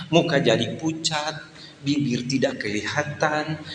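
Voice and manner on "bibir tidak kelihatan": ordinary talk, nothing marked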